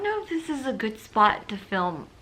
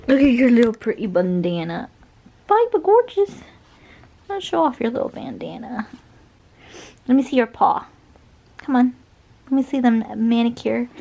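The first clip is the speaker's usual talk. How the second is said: doting pet talk; 3 semitones higher, 8 dB louder than usual. Real, not acted. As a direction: gush over the dog loudly. Praising the dog.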